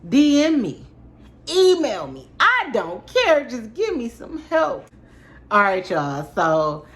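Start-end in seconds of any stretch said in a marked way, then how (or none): 0.1-4.9 pleading. Real, not acted. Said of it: "I'm stressing here".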